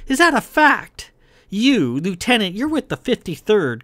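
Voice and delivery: high, loud confrontational jab; hostile challenge. A narrator's reading. Kai questioning Verlin's unit, hostile. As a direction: challenge the rival scornfully.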